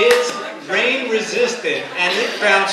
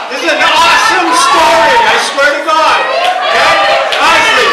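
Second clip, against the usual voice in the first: excited shout; 8 semitones higher, 8 dB louder than usual. Real, not acted. Hyping up his story.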